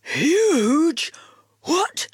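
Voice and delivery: loud eager dog bark. A narrator's reading. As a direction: blurt it out like an excited dog. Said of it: "woofed Dog".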